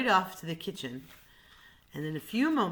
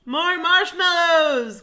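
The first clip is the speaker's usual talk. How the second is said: slow, high, loud demand; lion's cry; 11 semitones higher, 13 dB louder, slower than usual. Acted. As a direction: bellow for more, much higher and louder. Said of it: "cried the lion".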